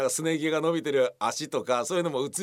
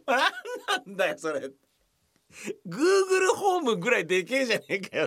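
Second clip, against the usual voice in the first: high voice breaking into laughter; laughing complaint; 9 semitones higher than usual. Retold or imitated. Laughing complaint to the actor about the prop. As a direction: protest while cracking up.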